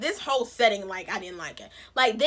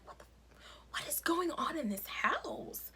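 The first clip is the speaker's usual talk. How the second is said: shocked whisper; 5 semitones higher, 11 dB quieter than usual. Retold or imitated. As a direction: whisper it as a stunned aside. Stunned aside at the family scene.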